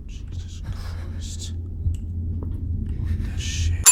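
shocked whisper. Real, not acted. Shocked by the scare.

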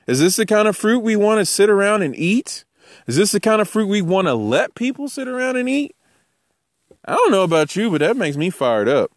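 ordinary talk, nothing marked

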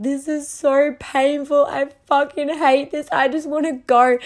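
high, loud mock wail of pain; hysterical crying. New mum in pain on the toilet, hysterical. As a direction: wail it out, frantic and high-pitched. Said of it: "in absolute hysterics, like, crying".

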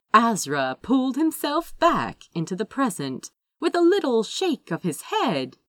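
plain reading voice

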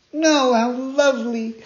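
high, loud delighted cry; joyful cry. Acted. Pinocchio overjoyed at the fairy's promise, high and loud. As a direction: burst out with joy. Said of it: "cried Pinocchio, jumping with joy".

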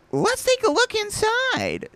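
high, drawn-out voice for Joe; high character voice. Acted. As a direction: read Joe's line high and slow. Acts out Joe's line from the book.

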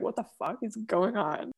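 imitated bewilderment, softly voiced; confused. Fan voicing Harry's reaction to her, soft confusion. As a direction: quietly act out someone's baffled reaction. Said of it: "He's, like, confused".

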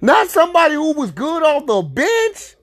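exasperated rant. Real, not acted. Rant at draft pick.

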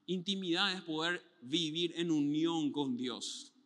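slow, soft and tender; sincere, slow. Real, not acted. Pastor closing on intimacy with God, slow and tender. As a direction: draw them in gently.

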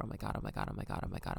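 low, hushed repetitive panic; panicked muttering. Retold or imitated. Working up to telling mom, panicked muttering. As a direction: mutter it under your breath, very low. Retold panicked inner thoughts.

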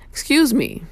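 "Excuse me" lifted high and falling, brisk; a quick bright polite ask. Shown as a demonstration. Showing riders another polite way to pass. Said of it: "two words can get you far in life".

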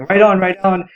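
mild mock yell to the rider; yelled urging. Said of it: "is yelling".

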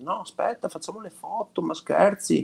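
high, excited pleading; playfully imitating eager friends; excited crowd voice. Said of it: "e la gente".

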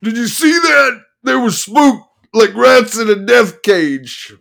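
high, loud manic lawyer; excited manic attorney. Acted. Attorney voiced, laughing.

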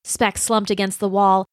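ordinary delivery, nothing marked

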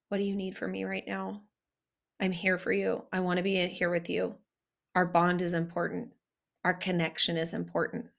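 low, gentle reassurance; soft, tender voice. Shown as a demonstration. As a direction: comfort a hurting partner, softly and steadily. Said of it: "soft, tender voice".

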